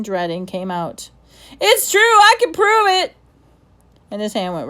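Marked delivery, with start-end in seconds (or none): 1.6-3.1 put-on boy desperate to prove his story, shouting; desperate outburst. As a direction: cry out to be believed.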